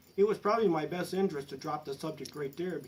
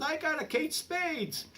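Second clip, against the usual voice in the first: much higher, slow wife voice; 12 semitones higher, slower than usual. Retold or imitated. Wife back from the purse pile, high and proud. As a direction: squeal the prize out proudly. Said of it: "she says".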